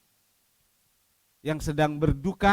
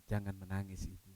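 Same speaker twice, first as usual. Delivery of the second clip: soft, tender and much quieter; lowered, kind, reassuring voice; gentle; 8 semitones lower, 14 dB quieter than usual. Acted. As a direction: speak as Jesus comforting the grieving mother. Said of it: "Disapa dengan lembut".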